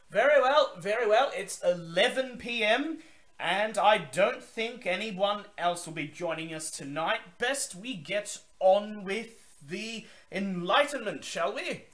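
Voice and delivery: high, loud, grand vampire host's welcome; Zachary Forge. Acted. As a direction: boom it out like a theatrical old vampire.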